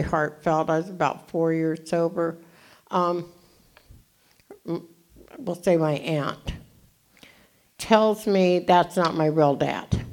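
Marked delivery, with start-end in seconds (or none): none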